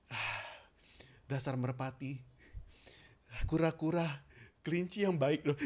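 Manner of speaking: smooth the brow and speak kindly; gentle and smooth. Shown as a demonstration. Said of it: "kita bikin kita mulus".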